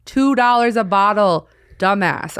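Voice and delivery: mock the rude front desk; rude clerk. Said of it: "they're like".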